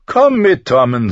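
high, loud, affected summons; feigned boredom. A narrator's reading. Prince calling younger brother away, affected boredom. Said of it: "Joffrey täuschte ein Gähnen vor".

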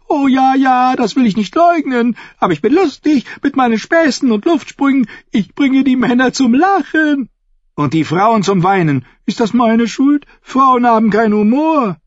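very high, loud, squawking fool; pleading jester. A narrator's reading. The fool defends himself to Brienne, high frantic pleading. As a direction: babble your excuses in a high jester's voice.

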